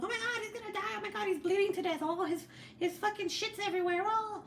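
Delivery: high-pitched panicky mimicry of a new parent; hammed-up panic, voice shooting way up; mock freak-out. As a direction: overreact as if it's a catastrophe. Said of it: "I would freak out about everything".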